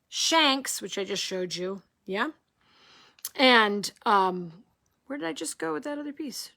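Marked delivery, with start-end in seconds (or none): 5.1-6.5 fast whisper to herself; teacher whispering while looking for a piece; whispered aside. Real, not acted. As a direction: mutter the search under breath. Teacher searching, talking to herself.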